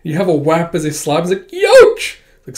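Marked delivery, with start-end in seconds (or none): 1.5-2.2 high, loud yelp; yelp of pain. Acted. Slapped character yelps at the hit, high yelp. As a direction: yelp like you've been slapped.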